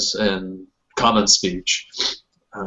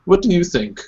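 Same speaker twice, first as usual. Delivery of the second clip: mother asking; 6 semitones higher than usual. Acted. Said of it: "she looks at you, Sarissa".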